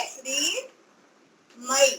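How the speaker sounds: श्रीमयी said very slowly in two parts, श्रीर् then मयी; teacher splitting श्रीमयी in two; split into two parts. Shown as a demonstration. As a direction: break श्रीमयी in two for the learner. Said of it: "ऐसा दो बार में पहले बोलिए".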